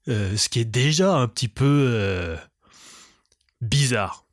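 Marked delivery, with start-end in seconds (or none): none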